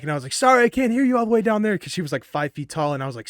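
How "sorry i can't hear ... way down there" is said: high, loud taunting shout; mocking yell. Tall guy taunting the short girl cussing him, mocking yell. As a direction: yell it down at someone much shorter. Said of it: "yelling at her back".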